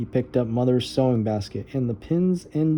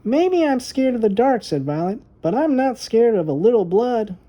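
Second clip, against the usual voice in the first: put-on higher, pert little girl voice; a girl talking back to her teasing brother; little girl Violet; 10 semitones higher, 4 dB louder than usual. A narrator's reading. Little sister answering her brother's teasing, pert high voice.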